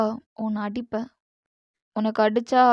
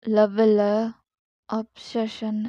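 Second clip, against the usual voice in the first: normal talking voice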